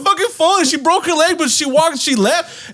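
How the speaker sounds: high, exasperated rant; panicked outburst. Retold or imitated. Explaining to strangers that she vanished, high panicked rant. Acting out his own panic.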